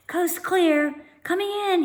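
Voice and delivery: put-on boy calling into the bush; shouting. A narrator's reading. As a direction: call out to sister. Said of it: "he shouted".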